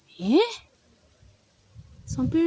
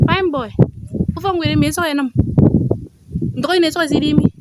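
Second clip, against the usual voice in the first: calling out; 6 semitones higher, 9 dB louder than usual. Real, not acted. Calling out to a small boy.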